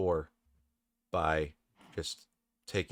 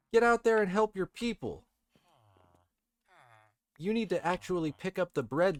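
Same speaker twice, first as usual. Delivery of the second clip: high-pitched command; 10 semitones higher, 4 dB louder than usual. Real, not acted. Ordering villagers around in game.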